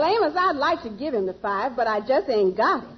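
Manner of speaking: ordinary delivery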